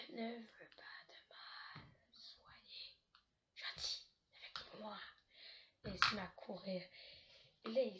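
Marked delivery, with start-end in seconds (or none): 0.1-5.0 chuchotement lent et inquiétant du clown; clown qui chuchote. Acted. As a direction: murmure doux et menaçant. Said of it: "qui dit comme ça". (clown sinistre qui chuchote lentement)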